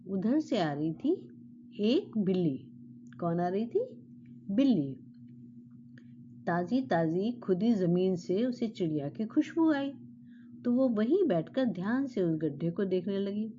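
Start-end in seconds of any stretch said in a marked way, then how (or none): none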